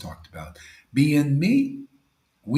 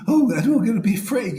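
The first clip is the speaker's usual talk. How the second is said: mocking timid devotee; 7 semitones higher, 5 dB louder, faster than usual. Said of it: "You ever see these devotees?".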